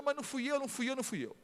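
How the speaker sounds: put-on high, tearful child's denial; a boy crying as he is sent to his room. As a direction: sob it out like a wronged boy. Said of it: "Ele foi chorando".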